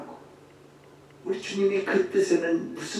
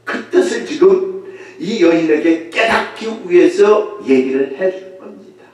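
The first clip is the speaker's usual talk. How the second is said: ordinary talk, nothing marked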